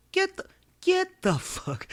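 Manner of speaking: "get the fuck" drawn out slowly; stretched-out emphasis on "get the fuck"; emphatic. Shown as a demonstration. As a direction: hit "get the fuck" slowly, high-pitched, word by word. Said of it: "you hear the emphasis?".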